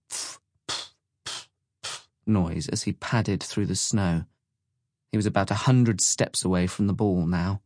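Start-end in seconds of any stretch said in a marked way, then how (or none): 0.1-4.2 gentle airy puffs imitating footsteps; soft footstep sounds. A narrator's reading. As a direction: puff softly like boots padding in snow. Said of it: "made a gentle".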